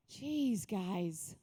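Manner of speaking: drowsy grumble, dropping low; sleepy Jesus. Acted. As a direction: groan it lightly, as if just woken. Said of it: "Sleepy Jesus wakes up".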